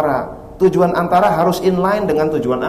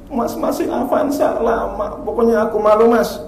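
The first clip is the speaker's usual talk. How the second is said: whiny wife; 7 semitones higher than usual. Retold or imitated. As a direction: sulk like the wife. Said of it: "istrinya berkata". (istri merengek, nada tinggi)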